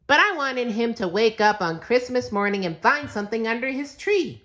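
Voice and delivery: high, bright squeaky cartoon sponge voice; cheerful SpongeBob. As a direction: chirp it like a happy SpongeBob.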